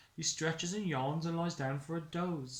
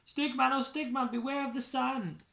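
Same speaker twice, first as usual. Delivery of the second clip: high, loud sing-song warning; warning refrain; 10 semitones higher, 4 dB louder than usual. Acted. Narrator warns Stickman of danger, high sing-song call.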